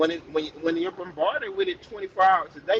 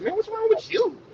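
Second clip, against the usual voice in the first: pitched way up, exasperated question; high mock exasperation. Father asking his moody daughter what's wrong, high exasperation. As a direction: squeak it out like a fed-up dad. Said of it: "I be like".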